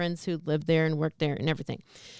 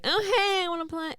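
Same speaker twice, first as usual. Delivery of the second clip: eager; 9 semitones higher, faster than usual. Retold or imitated. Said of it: "I was like".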